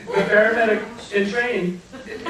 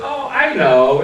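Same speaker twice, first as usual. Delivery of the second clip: sheepish; 9 dB louder, slower than usual. Retold or imitated. Answering the angel.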